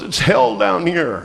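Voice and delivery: high, drawn-out mock complaint down the phone; narzekający rozmówca. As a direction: imitate a suffering caller, slow and high. Caller complaining from the jungle.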